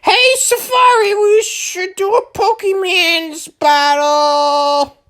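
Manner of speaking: eager rival voice. Retold or imitated. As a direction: challenge him cheerfully, dragging it out. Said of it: "He was like".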